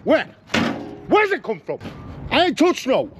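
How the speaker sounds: bewildered searching rant; real frustrated confusion; frantic exasperation. Real, not acted. Finds more grease.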